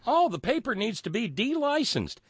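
high-pitched mock outrage; mocking echo. Host throwing guest's licensing demand back at him, mocking. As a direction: parrot it back with heavy sarcasm.

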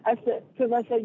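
ordinary talk, nothing marked

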